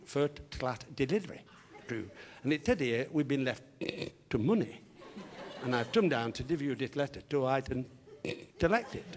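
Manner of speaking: comic speech defect, k and s turned to t; tongue-tied fronted consonants; Harry. Acted. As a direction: explain politely through impeded, t-heavy diction. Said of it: "a slight speech defect".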